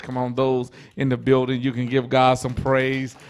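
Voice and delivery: usual speaking voice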